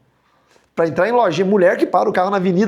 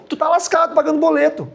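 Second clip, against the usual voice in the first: usual speaking voice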